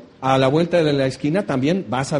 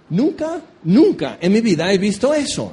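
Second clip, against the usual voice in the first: astonished gasp; 3 semitones higher than usual. Retold or imitated. Mourners seeing the dead man sit up, gasping in shock. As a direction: gasp in disbelief.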